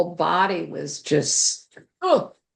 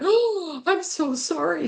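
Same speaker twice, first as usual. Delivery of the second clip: sorry; 10 semitones higher than usual. Retold or imitated. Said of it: "I went".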